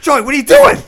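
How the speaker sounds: high, loud surprised call; surprised shout. Retold or imitated. Calling to invisible Joey.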